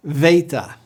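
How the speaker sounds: "Theta" said "VAY-ta", starting on English V; Greek beta with V. Shown as a demonstration. Teacher models the Greek letter for learners, V-sound beta. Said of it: "pronounced like our V instead of B".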